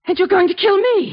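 realising she is next; terrified realisation. Acted. Amanda realises Theodore means to kill her, terrified.

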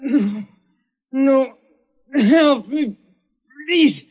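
high, strained pleading, slowing down; desperate begging. Acted. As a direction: beg for your life, voice cracking high. Dying man begs for help.

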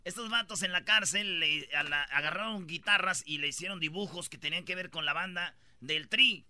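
ordinary talk

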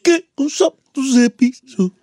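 light [name] take. Shown as a demonstration. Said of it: "Voy a imitar a ... [name]".

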